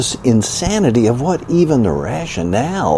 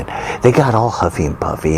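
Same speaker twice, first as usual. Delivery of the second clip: ordinary delivery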